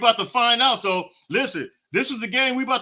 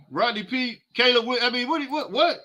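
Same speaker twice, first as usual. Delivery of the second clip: ordinary delivery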